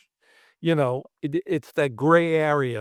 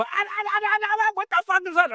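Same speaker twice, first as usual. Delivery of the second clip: much higher, fast panicked director voice; faster than usual. Retold or imitated. Director running over to the effects crew, high and panicked. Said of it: "The director comes running over".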